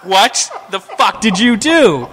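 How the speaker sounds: slow, high, loud mock outrage; appalled disbelief. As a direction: draw out each word, aghast. Confronting friend with torn wallpaper.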